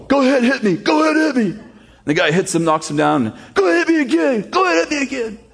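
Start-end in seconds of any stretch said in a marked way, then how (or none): 0.1-1.6 high, strained taunting voice; hammed-up punch-drunk dare, pitched way up; goading boxer. Rocky keeps goading the opponent. 3.6-5.4 high, strained taunting voice; hammed-up punch-drunk dare, pitched way up; goading boxer. Rocky goads after knockdown.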